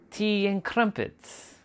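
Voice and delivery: high, slow mock British voice; British accent. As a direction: put on a drawn-out, high-pitched British accent. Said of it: "speaking with their British accent".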